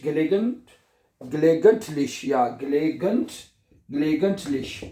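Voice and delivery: slow gelegent- split into parts; slow broken pronunciation. Shown as a demonstration. Teacher breaking a long word into parts. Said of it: "और उसके बाद".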